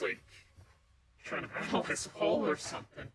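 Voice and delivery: usual speaking voice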